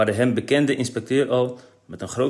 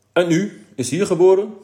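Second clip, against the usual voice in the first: high, loud mock official question; boy parroting a pompous official; mocking the inspector; 10 semitones higher, 9 dB louder than usual. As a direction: ape the stiff polite inspector. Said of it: "praatte Kees de inspecteur na".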